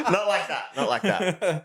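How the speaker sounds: high laughing protest; real laughter cutting the words; laughing denial. Real, not acted. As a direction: giggle through the denial. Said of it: "No, no, not like that".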